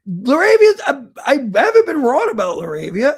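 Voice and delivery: high, loud protest; indignant protest. Real, not acted. Protests at being called wild.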